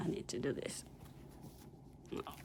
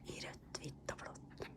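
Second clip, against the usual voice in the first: whisper; 16 dB quieter than usual. Real, not acted. Whispered aside to viewers.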